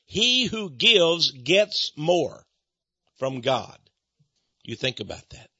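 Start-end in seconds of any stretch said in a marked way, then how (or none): 0.1-3.8 very slow, word by word; drawn-out, deliberate preacher's emphasis; emphatic repeat. Real, not acted. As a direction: spell it out slowly so they understand. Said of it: "I want you to understand it".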